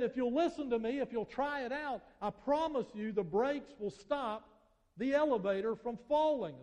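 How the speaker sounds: a preacher voicing an inventor's plea; pleading inventor. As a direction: beg the crowd to trust him.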